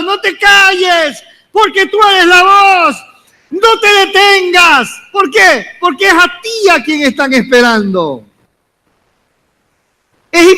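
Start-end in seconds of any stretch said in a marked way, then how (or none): 0.0-8.2 high, impassioned call to the church; fervent preaching. Acted. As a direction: fire them up to repeat it. Congregation told to repeat loudly. (llamado ferviente y agudo a la congregación)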